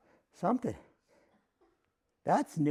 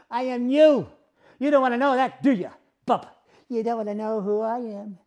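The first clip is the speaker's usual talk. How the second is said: ordinary talk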